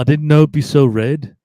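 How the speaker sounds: a loud, light Liverpool-Beatle voice, amazed; lightly voiced Beatle marvelling at a red planet; [name] on Mars. Host retelling Dana Carvey's micro impression, loud light McCartney voice. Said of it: "he does an impression of [name] on Mars".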